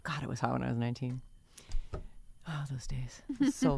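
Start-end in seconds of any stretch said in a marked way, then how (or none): 2.5-3.2 wistful whisper. Real, not acted. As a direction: sigh it in a whisper. Nostalgic whispered sigh.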